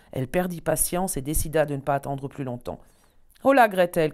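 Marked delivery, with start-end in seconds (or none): 3.4-4.1 loud impatient witch cry; shouted call. Acted. Witch calling Gretel loudly, out of patience. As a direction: bellow her name, losing patience. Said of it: "cria-t-elle".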